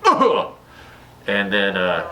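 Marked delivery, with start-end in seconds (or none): 0.0-0.5 high, sharp mock death grunt; hammed-up dying gasp. Dracula's staked death grunt, high and sharp. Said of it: "Makes a sound like".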